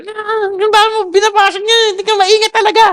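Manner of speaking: high, scolding parent voice; old angry reaction. Retold or imitated. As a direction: snap at the child over the broken thing. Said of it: "Yung dati kong reaction". (pasigaw na pagsaway ng magulang)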